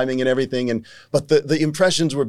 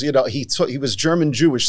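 normal talking voice

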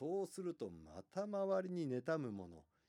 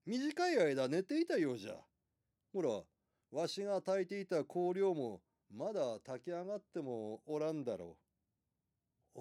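put-on creaky wise old man; 3 semitones higher, 6 dB louder than usual. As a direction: speak as a kindly old innkeeper. Old innkeeper explains.

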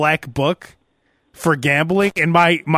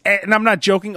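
usual speaking voice

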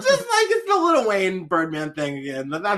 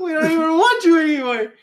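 high put-on voice; mock indignant rejection; 10 semitones higher, 4 dB louder than usual. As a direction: reject them in a hammed-up high voice. Joins mock eviction.

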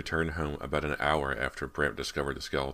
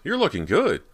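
eager compliment; 11 semitones higher, 8 dB louder than usual. A narrator's reading. Brant flattering Abby.

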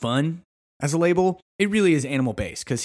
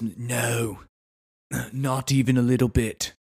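understated mock British accent, nearly Australian; British gone Australian; 2 semitones lower, 3 dB quieter, slower than usual. Bear Grylls dismissing concern, accent drifting Australian. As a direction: let the British accent slide toward Australian. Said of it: "I think I'm almost more Australian here".

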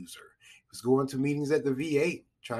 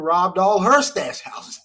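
higher and louder as the laugh takes over; breaking into laughter; 4 semitones higher, 6 dB louder, slower than usual. Real, not acted. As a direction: tell it louder, tipping into a real laugh. Breaks into laughter telling plot.

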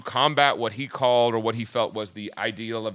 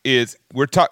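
ordinary talk, nothing marked

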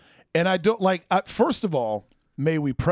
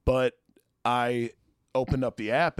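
ordinary delivery

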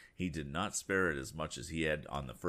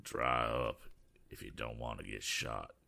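growled; 7 semitones lower than usual. Acted. Robber silencing the eccentric man, low growl. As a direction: growl a threat at him.

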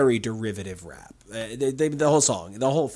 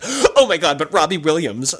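gushing outburst; 2 semitones higher, 7 dB louder than usual. Retold or imitated. Host imitates herself gushing to a guest, gushing outburst. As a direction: blurt it out like a thrilled fan. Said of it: "me being like".

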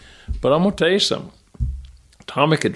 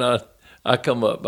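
usual speaking voice

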